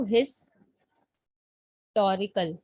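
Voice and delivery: "हिस्टोरिकल" broken after "हिस", long pause, then "टोरिकल"; teacher separating "हिस" from "टोरिकल"; split into syllables. Shown as a demonstration. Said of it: "syllable क्या होता है ये भी मैं आपको बता देती हूँ".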